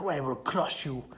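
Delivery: lightly voiced Russian boxer threat; a hint of a cold Russian fighter, low; Rocky Four Ivan voice. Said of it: "you say that in your favorite Ivan Rocky Four voice".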